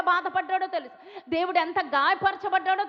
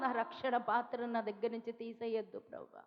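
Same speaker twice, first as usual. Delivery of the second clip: softer, hushed pleading prayer; a quiet, imitated plea to God; mild tearful plea; 5 semitones lower, 11 dB quieter than usual. David begging God in the psalm, softly pleading. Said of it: "దావీదు కీర్తనలో ఏడుస్తాడు".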